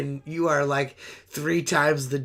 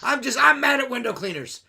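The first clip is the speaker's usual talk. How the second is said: loud, high, ranting mock complaint; mock angry customer; 8 semitones higher, 6 dB louder than usual. Window cleaner mocks an irate reviewer, loud ranting voice. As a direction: shout it like an irate one-star reviewer.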